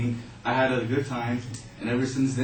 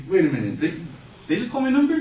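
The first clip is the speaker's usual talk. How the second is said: shocked to hear her number; stunned disbelief; 9 semitones higher, 7 dB louder, faster than usual. Retold or imitated. Hearing her name for first place, stunned disbelief. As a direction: voice jumping high and loud in disbelief.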